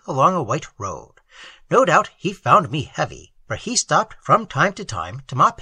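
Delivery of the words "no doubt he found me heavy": ordinary delivery, nothing marked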